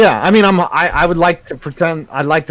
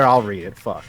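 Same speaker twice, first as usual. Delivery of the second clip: ordinary delivery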